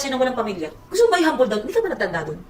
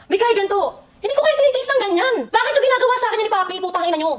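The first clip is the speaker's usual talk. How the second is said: high, heated scolding; rising anger at Miguel; angry outburst; 7 semitones higher than usual. Real, not acted.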